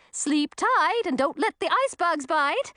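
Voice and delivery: put-on high, sugary old witch; sing-song witchy good night; sweet witch voice. Witch blows them kisses.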